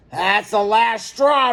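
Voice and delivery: slow and heavy, losing his temper; roaring. A narrator's reading. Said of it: "roared Mister Clack".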